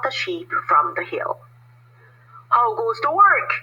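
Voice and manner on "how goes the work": higher farmer call. A narrator's reading. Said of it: "called the farmer".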